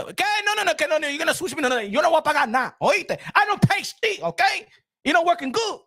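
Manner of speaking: high, fast hammed-up protest; mock angry refusal. Streamer retelling refusing the billing department, mock angry protest. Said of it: "I said".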